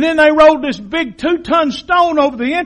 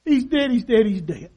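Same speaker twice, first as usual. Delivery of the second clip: soft, falling lament; Mary mourning her son, softly; grieving mother; 2 semitones lower, 6 dB quieter than usual. Retold or imitated. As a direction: let it fade into quiet grief. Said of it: "cried out".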